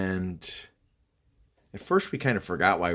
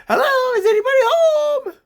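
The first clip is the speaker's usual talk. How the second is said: put-on high, loud childish call with a laugh; kid's voice; 12 dB louder than usual. Imitating Bobby calling like a kid into the house. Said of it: "He sounded like a kid".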